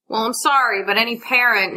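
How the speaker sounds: normal talking voice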